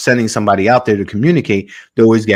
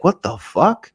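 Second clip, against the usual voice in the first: retold disbelief; 7 semitones higher, 3 dB quieter than usual. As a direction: voice the baffled pilot's mild astonishment. Pilot baffled by green Antarctica.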